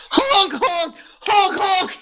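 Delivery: high repeated goose honks. Retold or imitated. Voicing Gordon Honky the goose chef, high honks. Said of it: "Gordon Honky right here".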